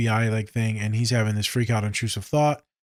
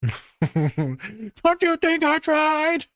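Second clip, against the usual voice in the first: very high, drawn-out screech; frantic mock shriek; much slower than usual. Imitating a client answering "calm down", frantic. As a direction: screech each word slowly, hammed-up.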